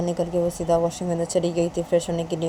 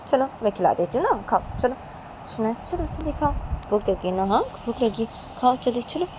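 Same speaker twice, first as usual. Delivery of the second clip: lightly acted coaxing, higher pitch; mother feeding child; 6 semitones higher than usual. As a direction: coax her to eat in a raised pitch.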